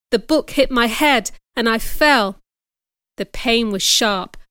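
usual speaking voice

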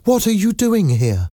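very high-pitched question; surprised. A narrator's reading. Young woman finding Eponine outside, surprised. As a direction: ask with pitch jumping high. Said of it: "Cosette said in surprise".

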